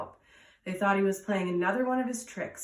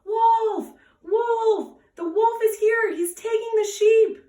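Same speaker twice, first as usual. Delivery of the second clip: high, loud desperate shout; crying out; 13 semitones higher, 9 dB louder, slower than usual. Acted. Shepherd boy sees a real wolf, desperate high shout. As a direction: scream for help, it's real this time. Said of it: "and cried out".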